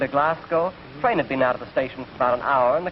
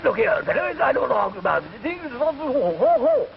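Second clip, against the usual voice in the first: high, garbled British bluster; caricature British gentleman; 8 semitones higher than usual. Acted. British diner arguing with waiter, high garbled British bluster. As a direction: splutter into hooting nonsense in exaggerated British. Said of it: "Man said".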